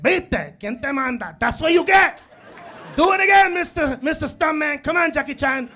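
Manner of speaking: loud, high taunting scold; mocking scold. Father mocking his son after the fall, loud and high. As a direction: taunt your kid for showing off. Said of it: "I was like".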